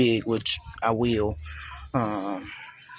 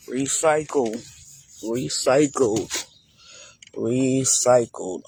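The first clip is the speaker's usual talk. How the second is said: drawn-out slogan; slower than usual. Real, not acted. Slow emphatic slogan repetition.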